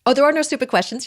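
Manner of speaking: bright emphatic reassurance; brushing off the worry with energy. Real, not acted. Reassuring the interviewer.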